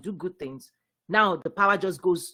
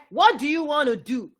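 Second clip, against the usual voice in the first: high, insistent question; raised-pitch demanding question; acted challenge; 6 semitones higher, 4 dB louder than usual. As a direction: put the question as a pressing demand. Voicing the system questioning you.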